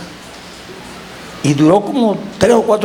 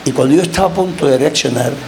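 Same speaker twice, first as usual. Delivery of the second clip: ordinary talk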